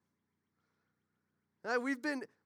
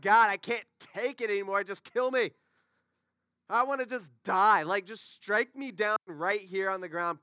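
loud, hammed-up desperate plea; exasperated outburst; 7 dB louder than usual. Moses fed up with complainers begging God, acted exasperation. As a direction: cry out to God as if at breaking point. Preacher acts Moses at breaking point.

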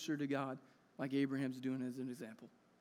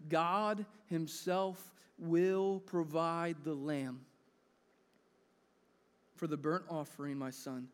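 gentle father's answer; slower than usual. Acted. Abraham answers his son gently.

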